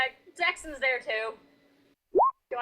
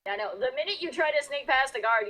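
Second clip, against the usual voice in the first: usual speaking voice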